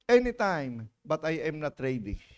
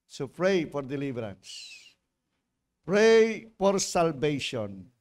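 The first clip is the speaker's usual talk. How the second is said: slow emphasis; 3 semitones lower, slower than usual. Shown as a demonstration. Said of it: "slow emphatic teaching".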